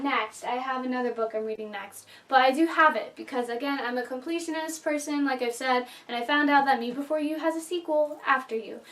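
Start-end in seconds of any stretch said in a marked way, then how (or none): none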